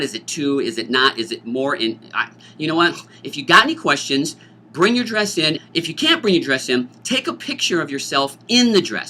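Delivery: ordinary talk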